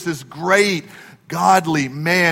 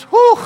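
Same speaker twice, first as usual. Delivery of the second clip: a sharp high whew, way up; mock pained whew at a bad voice; high mock whistle of dismay; 8 dB louder than usual. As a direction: let out a shocked high whew. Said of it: "what he was really saying is".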